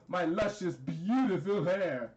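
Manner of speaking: slow, drawn-out mock boasting; hammed-up vanity. Villain unveils luscious hair, hammed-up vanity. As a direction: savour each word in mock self-admiration. Character shows off his hair.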